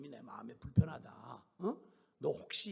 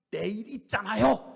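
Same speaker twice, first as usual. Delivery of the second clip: loud, higher defiant snap; spat-out retort; 6 semitones higher, 15 dB louder than usual. Retold or imitated. Sister glaring and retorting at the elder, defiant. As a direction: spit it back at her. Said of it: "말을 탁 뱉어내더래요".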